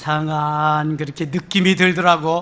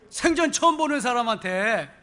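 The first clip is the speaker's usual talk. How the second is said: ordinary talk, nothing marked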